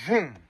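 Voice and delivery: quick vroom sound effect; imitated engine vroom. Getaway driver hitting the gas, imitated engine vroom. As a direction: rev it out like a car taking off.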